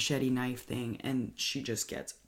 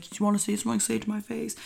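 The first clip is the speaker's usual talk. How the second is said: mild mock yell; 8 semitones higher, 4 dB louder, much faster than usual. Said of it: "screaming at the top of their lungs".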